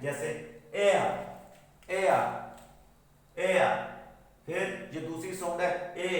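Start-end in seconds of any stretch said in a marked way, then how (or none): 0.7-3.9 एयर as a falling "ay-a" glide; practice drill. Shown as a demonstration. Teacher leading students in revision practice, gliding falling एयर. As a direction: sound out एयर separately, pausing after each. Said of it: "एक बार दोबारा practice करते हैं".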